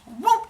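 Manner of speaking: a sudden high whoop of magic. Acted. Metis shapeshifting into a hippo, high playful whoop. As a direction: pop the sound up very high. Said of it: "And she goes".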